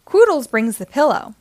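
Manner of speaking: plain reading voice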